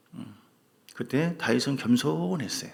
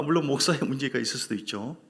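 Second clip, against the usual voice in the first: ordinary talk, nothing marked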